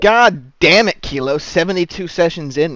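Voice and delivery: exasperated. Real, not acted. Exasperated GM at player.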